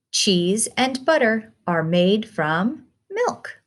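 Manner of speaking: ordinary delivery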